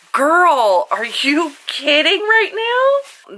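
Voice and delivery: high, slow incredulous squeal; disbelief. Real, not acted. Vlogger unwrapping Melanie's gift palette, high slow delighted disbelief.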